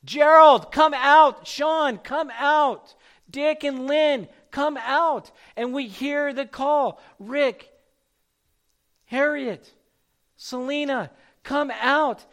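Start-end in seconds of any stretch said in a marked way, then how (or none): 0.0-5.3 loud, higher calls to each name; calling out. Acted. Calling named people out. 7.2-12.2 calling out. Acted. Calling named people out.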